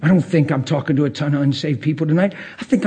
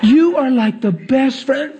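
mock gushing praise; 8 semitones higher, slower than usual. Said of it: "And you say".

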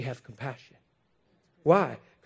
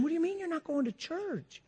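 a hint of the wife; 6 dB quieter than usual. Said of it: "wife's reply voiced".

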